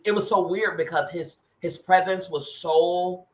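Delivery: ordinary delivery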